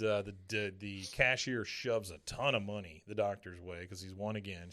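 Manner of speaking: ordinary delivery, nothing marked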